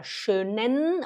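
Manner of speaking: "schönen" slowed with a long final n; careful, stretched "schönennn"; N emphasised. Shown as a demonstration. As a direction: hold the n at the end of "schönen". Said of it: "olha o N".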